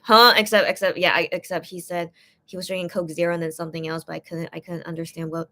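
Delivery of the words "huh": loud, startled "Huh?"; a sudden confused yelp; surprised huh. Retold or imitated. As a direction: caught off guard, blurting it out. Reacting to him drinking beer.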